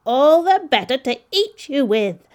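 loud, high villainous shout; explosive storybook wolf roar; wolf's cry. A narrator's reading. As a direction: pounce on the words as the wolf. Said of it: "cried the wolf".

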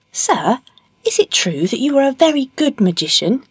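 high, polite questioning voice; flattering cat. Acted. Puss questioning the ogre at his castle, flattering.

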